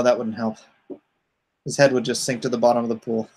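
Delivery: usual speaking voice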